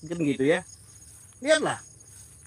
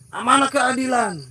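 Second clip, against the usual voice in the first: higher pitched mocking wail for justice; mock outcry; 6 semitones higher than usual. Preacher parodies opponents crying for humanity, mock outcry. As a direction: ham up their indignant complaint. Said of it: "teriak-teriak".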